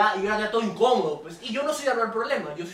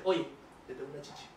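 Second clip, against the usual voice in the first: dropped to a near-hush, barely there; hushed aside; 7 semitones lower, 12 dB quieter than usual. Retold or imitated. Shy boy telling a girl something embarrassing, hushed. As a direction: lean in and say it under your breath.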